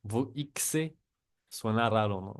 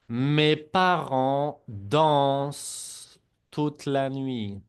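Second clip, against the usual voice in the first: Mes parents dansent said very slowly, silent -ent on dansent; slow model sentence; 5 dB louder, much slower than usual. Shown as a demonstration. Teacher slowly models the sentence for students to repeat. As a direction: spell it out slowly for the class to repeat. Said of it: "la E N T no se pronuncia".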